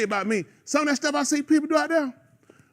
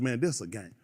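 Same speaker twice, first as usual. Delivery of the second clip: quiet; 13 semitones lower, 11 dB quieter than usual. Retold or imitated. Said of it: "I said".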